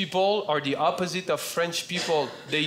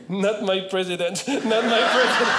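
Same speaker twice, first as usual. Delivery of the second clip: comic American protest wail, building louder; hammed-up American sob, rising on the repeat; mock American-accented crying; 4 semitones higher, 4 dB louder than usual. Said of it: "he cries with an American accent".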